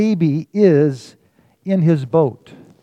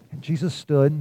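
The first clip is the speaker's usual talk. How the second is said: usual speaking voice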